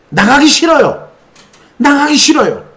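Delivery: high sulky refusal; whining demon. Retold or imitated. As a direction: whine like a stubborn child-demon.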